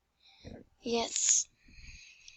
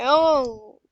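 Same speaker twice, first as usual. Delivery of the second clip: normal talking voice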